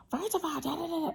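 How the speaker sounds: high mock scolding rant; high, quiet mock rant; mock lecturing rant. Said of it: "I'm not talking about, like".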